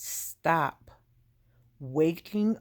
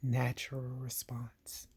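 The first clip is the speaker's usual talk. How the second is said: whisper; 2 semitones lower, 7 dB quieter than usual. Real, not acted. Quiet aside after emotional story.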